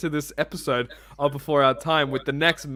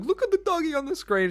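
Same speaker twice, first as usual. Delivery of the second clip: put-on squeaky high baby-talk gush; excited; 14 semitones higher than usual. Waking his sleeping girlfriend to show her the dog, squealing high. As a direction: coo it like you just spotted a puppy.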